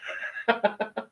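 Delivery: breathy laugh. Real, not acted. Laughs at own Tony Skank joke.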